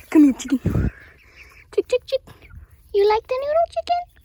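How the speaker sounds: high, loud chicken call. Real, not acted. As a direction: call the hens over sweetly. Calling chickens to eat.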